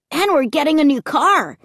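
high, excited delight; happy exclamation. A narrator's reading. Luke rejoicing over the new car, happy. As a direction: exclaim it happily. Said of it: "Luke exclaimed happily".

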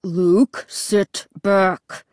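fed-up father's growled order; growling. A narrator's reading. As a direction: growl the warning, spacing it out. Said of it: "Dad growled".

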